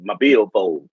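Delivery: put-on low, slow drawl of an old cowboy; a lightly voiced old cowboy from a saloon; old Western cowboy. Imitating an old cowboy in a saloon, low drawl. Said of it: "Last time I heard a motherfucker say".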